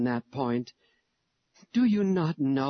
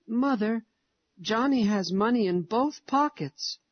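put-on little boy voice; 7 semitones higher than usual. A narrator's reading. Little boy speaks to mother.

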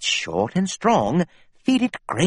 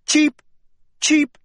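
high, loud chick cheeping; loud bird chirping; 5 dB louder than usual. Acted. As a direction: chirp like a distressed baby bird. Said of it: "I heard a loud chirping".

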